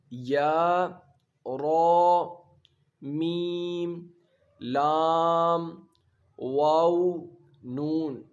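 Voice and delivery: each letter name called out distinctly; letter recitation. Shown as a demonstration. Teacher reciting letters for children, distinct calls. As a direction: drill the letters one by one. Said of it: "حروف یرملون".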